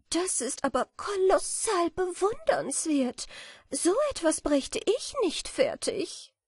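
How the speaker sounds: put-on high, light, dreamy girlish voice; a naive little butterfly imagining honey; sweet and high; innocent butterfly. A narrator's reading. Butterfly marvels.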